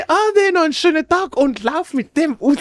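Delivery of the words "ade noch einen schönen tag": much higher, sing-song farewell; a high, friendly cashier goodbye; mock friendly cashier. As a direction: chirp it like a polite shop lady.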